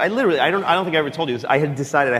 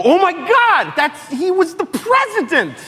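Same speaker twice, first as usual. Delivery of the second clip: much higher, louder, slow starstruck gasp; starstruck excitement; 4 dB louder, slower than usual. Retold or imitated. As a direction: gush in disbelief at the ex-president. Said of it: "I went crazy".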